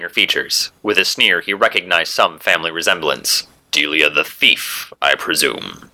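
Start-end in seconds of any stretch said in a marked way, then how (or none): 3.7-5.9 low, slow, icy hero voice; cold question. A narrator's reading. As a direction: ask it coldly, slow and deep. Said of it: "he coldly asked".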